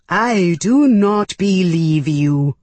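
slow, loud creature voice; drawn-out, forceful alien growl. A narrator's reading. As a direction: declare disbelief as a growling alien. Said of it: "This was the growling voice".

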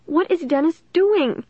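high, small scared question; tiny, frightened voice. A narrator's reading. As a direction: ask in a high, frightened little voice. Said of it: "in a tiny, frightened voice".

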